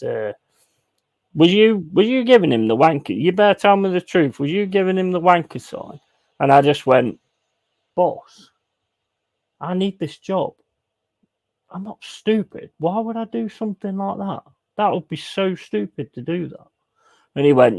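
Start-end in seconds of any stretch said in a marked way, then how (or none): 1.3-6.0 raised-pitch mock telling-off; stern interrogation. Manager interrogates him, retold. 7.9-16.7 higher, softer pleading; feigned innocence. Retold or imitated. Feigned innocence to boss, retold.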